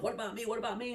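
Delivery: tiny guy on the table begging the panel, high and pleading; little fellow pleading. Retold or imitated. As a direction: hop up and down begging to be noticed.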